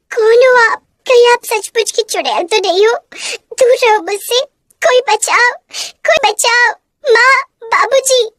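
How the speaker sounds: put-on girl terrified by a witch at night; terrified child. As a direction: scream for help in fear.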